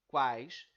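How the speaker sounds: quais as one loud, isolated syllable; one single emission. Shown as a demonstration. Teacher pronouncing the first part of the word, quais loud in one emission. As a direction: punch out quais all in one beat. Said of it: "pronunciamos toda ela em uma única emissão".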